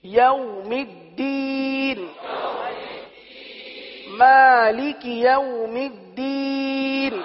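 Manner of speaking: slow, melodic chanted recitation; Quran recitation. Acted. Teacher chanting the next verse to the class. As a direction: intone it slowly like tilawat.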